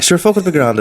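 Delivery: high West Cork lilt, shrugging it off; mock West Cork shrug. Friend imitating the farmer brushing off the damage, high West Cork lilt. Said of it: "I'd say".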